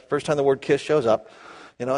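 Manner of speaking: normal talking voice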